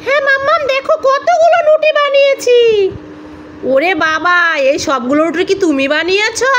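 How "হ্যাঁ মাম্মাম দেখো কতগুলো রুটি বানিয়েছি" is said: put-on high, louder proud little boy; child voice. Little son showing mummy his rotis, in a high proud child voice. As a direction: show off like a proud toddler.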